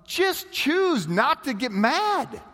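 high, slow, easy-going; lightly voiced calm counsel; drawn out and unbothered; breezy advice. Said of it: "but he says".

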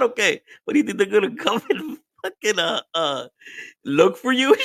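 high-pitched, laughing retelling; cracking up. Real, not acted. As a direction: talk through a real fit of laughter. Cracks up retelling wife hiding.

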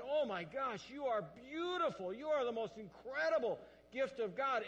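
loud, high gushing praise; excited praise. Retold or imitated. Husband praising his wife, loud and high. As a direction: gush it out with wonder. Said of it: "And he said".

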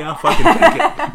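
high, loud punchline; loud cocky reply to his coworker; loud defiance. Retold or imitated. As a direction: shout it out cockily. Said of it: "I go".

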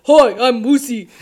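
loud, silly puppet voice; goofy puppet introducing himself with gusto; Moosey. Acted. Puppet greets the whole class, silly and loud. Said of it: "talks to everybody with a Moosey voice".

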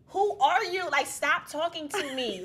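high, loud comic indignation; indignant retort. Retold or imitated. Telling the unknown voice off, indignantly. Snapping back at the voice.

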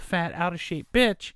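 ordinary delivery

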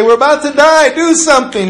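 high, loud panicked cry to wake him; panicked shout. Retold or imitated. Terrified fishermen shouting at sleeping Jesus, loud and high. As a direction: fishermen yelling in fear of dying. Disciples waking Jesus in storm.

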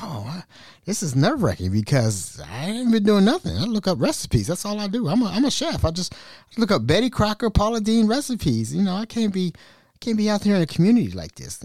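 high, soft panicky pleading; mock nervous. Caught by a scammer, he nervously insists he's innocent. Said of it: "I was a little nervous".

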